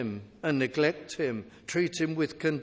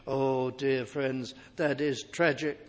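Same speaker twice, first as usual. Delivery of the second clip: normal talking voice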